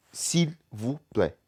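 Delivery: s'il, vous, plaît separated slowly; slow word by word. Shown as a demonstration. Teacher breaking down the phrase, slow word by word.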